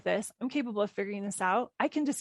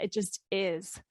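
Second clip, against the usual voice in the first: usual speaking voice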